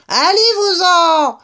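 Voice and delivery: high, loud, drawn-out squawk of a rat; curt rat. A narrator's reading. Rat ordering intruders out of his hole, curt squeal. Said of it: "fit-il sèchement".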